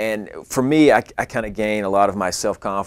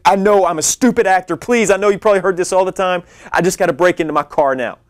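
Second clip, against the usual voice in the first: higher, louder, fast frantic plea; desperate begging; 10 semitones higher, 6 dB louder, faster than usual. Retold or imitated. Begging the dealer again, desperate and fast. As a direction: plead as if you're out of time. Begging stranger to open locked car.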